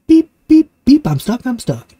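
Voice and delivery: high, loud frantic beeps; desperate beeping. Acted. Stuck truck calling friends, high loud beeps. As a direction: honk for help, desperate and stuck. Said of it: "cried Little Blue Truck".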